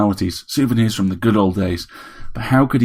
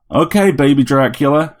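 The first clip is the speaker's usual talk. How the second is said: loud, raised-pitch dare; defiant taunt; 6 semitones higher, 5 dB louder than usual. A narrator's reading. Harry challenges the attacking bloodbot, defiant. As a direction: call out a challenge to your attacker.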